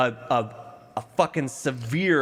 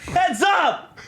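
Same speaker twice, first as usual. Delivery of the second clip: shouted warning; 13 semitones higher than usual. Pretend. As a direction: yell it like a warning. Calling out warning.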